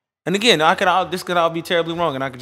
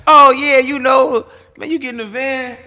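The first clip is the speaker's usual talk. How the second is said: much higher, louder mock teammate voice; animated retold quote; 11 semitones higher, 10 dB louder than usual. Retelling tumblers outside the van, animated mock quote. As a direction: act out the teammate, pitched well up.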